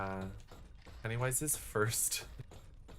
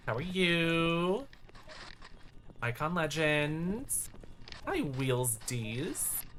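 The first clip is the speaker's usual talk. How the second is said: drawn-out greeting; 5 semitones higher, much slower than usual. Real, not acted. Slow drawn-out stream greeting.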